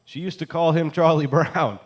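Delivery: loud and high, laughing through it, the last word slowed; shouted repeat breaking into a laugh; shouting it out. Acted. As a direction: yell it at full volume over a laugh. Final loudest repeat, laughing.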